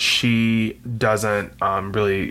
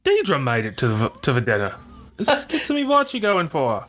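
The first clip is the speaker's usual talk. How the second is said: put-on a rough jokey Australian accent, rising high at the end; mock Australian accent; 4 semitones higher than usual. Cohost imitates Deidre at dinner, jokey Australian accent. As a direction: do a playful Australian accent, leaping up in pitch. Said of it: "my accent's good".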